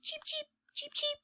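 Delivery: high, soft, tiny cheeping; baby bird chirps. Acted. Narrator voicing the hatched chicks, high and soft tiny cheeps. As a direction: peep like a newborn chick. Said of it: "The baby bird says".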